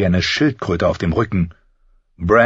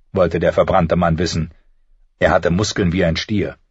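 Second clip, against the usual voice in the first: ordinary delivery, nothing marked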